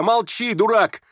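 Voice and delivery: high, angry insult; shouting. Acted. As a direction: yell to shut him up, high-pitched. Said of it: "закричал Коля". (сердитый высокий крик)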